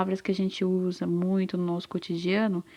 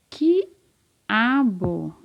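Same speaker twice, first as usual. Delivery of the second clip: "quiabo" drawn out as qui-a-bo; syllable by syllable. Shown as a demonstration. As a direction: split "quiabo" into long, separate syllables, qui, a, bo. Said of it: "Começa com qual sílaba?". ("quiabo" bem separado, qui-a-bo)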